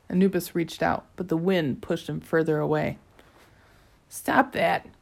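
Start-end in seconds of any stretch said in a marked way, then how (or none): 4.1-4.9 squeaky voice. A narrator's reading. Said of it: "said a squeaky voice".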